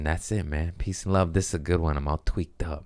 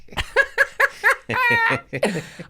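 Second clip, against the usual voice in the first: cracking up in a high squeal; high shrieking laugh. Real, not acted. Vlogger cracking up at his own joke, high squealing laugh.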